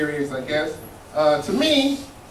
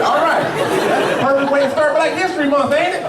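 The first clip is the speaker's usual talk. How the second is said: mock cheerful; 6 semitones higher than usual. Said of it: "I said".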